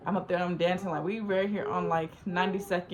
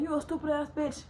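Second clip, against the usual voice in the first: higher-pitched mock insult; retold going off; 7 semitones higher than usual. Rejected catfish insults her in a quarrel, going off. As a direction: throw the insult back, pitched up. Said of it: "We going off on each other".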